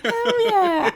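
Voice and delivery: high, loud laughing whoop of hell yeah; Jenna Marbles hell yeah. Retold or imitated. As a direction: shout it like a delighted catchphrase. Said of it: "that's a Jenna quirk".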